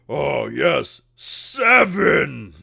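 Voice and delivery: high, loud ogre crowing with a drawn-out last word; booming ogre. Acted. Ogre counting up the gold, booming. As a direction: bellow it like a delighted big ogre. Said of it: "he is there and he goes".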